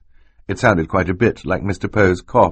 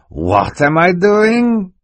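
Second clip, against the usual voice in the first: high, drawn-out incredulous question; hammed-up innocence, loud and slow; mock-innocent villain; 13 semitones higher, 5 dB louder, much slower than usual. A narrator's reading. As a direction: stretch it out slowly in feigned astonishment. Stefano feigns innocence when accused.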